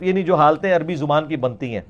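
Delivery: ordinary talk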